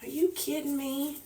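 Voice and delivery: high, soft incredulous groan; fed up with the glare; exasperated. Real, not acted. Annoyed at glasses glare.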